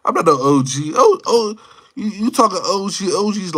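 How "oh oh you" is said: ordinary talk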